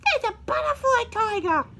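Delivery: high, loud "oh no" of alarm; a high alarmed wail; frightened cry. Acted. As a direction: squeal it out in fright. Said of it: "said Mama Sa".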